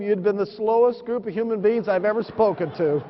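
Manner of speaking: normal talking voice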